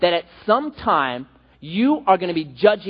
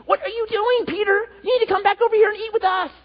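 much higher, fast squeaky voice of a little indignant man; squeaky Paul. Retold or imitated. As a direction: pipe up high like a tiny guy scolding a giant. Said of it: "just kinda squeaky voice".

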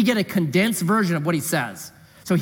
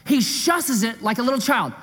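usual speaking voice

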